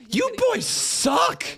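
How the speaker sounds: high teasing shout; shouted taunt. Pretend. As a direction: yell it at the boys. Mock taunt of friends.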